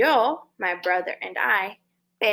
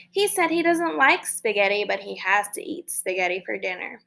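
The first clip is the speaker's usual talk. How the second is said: ordinary talk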